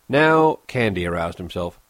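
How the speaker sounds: usual speaking voice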